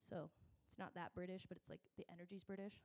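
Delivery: ordinary talk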